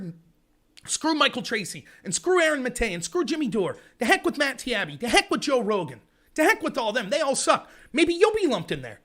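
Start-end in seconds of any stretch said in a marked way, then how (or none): none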